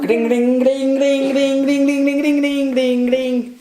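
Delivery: high playful gling chant; chanted gling sound. Pretend. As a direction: ring it out like a little bell. Imitating bell or magic sound.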